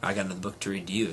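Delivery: plain reading voice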